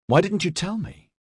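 high-pitched, hurt reproach; exasperated, bitter question; bitter exclamation. A narrator's reading. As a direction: demand it bitterly, pitched well up. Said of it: "Edyard exclaimed bitterly".

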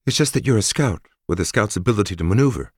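female soldier voice. Acted. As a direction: plead gently on his behalf. Narrator voices Forrest.

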